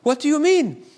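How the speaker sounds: mock-indignant German accent. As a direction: imitate a baffled German caller. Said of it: "I'll do my German accent".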